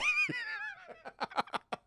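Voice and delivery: helpless squealing laugh; high burst of laughter. Real, not acted. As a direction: crack up at the scene. Streamer cracks up.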